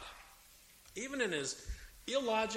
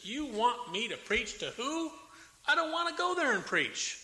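higher, rising to a loud outraged refusal; indignant protest; 6 semitones higher, 6 dB louder than usual. Retold or imitated. Jonah refusing God's command, indignant and loud. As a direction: balk at the order.

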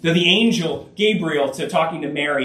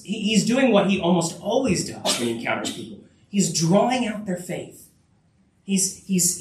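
normal talking voice

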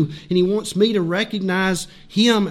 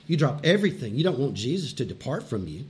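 ordinary talk, nothing marked

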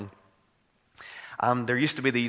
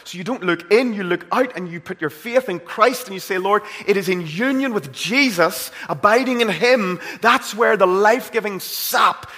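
higher, much louder, climbing to a peak; preaching at full force; 8 semitones higher, 8 dB louder than usual. Acted. Preacher urging the congregation to look to Christ, fervent preaching. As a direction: build to a ringing climax.

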